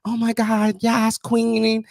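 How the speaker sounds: high, stretched-out gushing impression; bubbly sister-in-law. Husband mimicking his wife's sister at a family visit, mock bubbly. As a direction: gush it sky-high like a bubbly girl. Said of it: "She's one of those bubbly".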